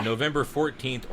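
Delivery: ordinary talk, nothing marked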